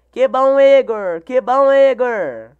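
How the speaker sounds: happy reply to a viewer's news; excited. Real, not acted. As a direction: cheer for the viewer.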